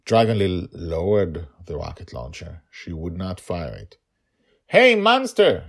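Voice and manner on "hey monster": high, loud challenge; yelled with all her strength. A narrator's reading. Girl yells at monster to draw it off, full-strength yell. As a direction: bellow to draw him away. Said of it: "she yelled with all her strength".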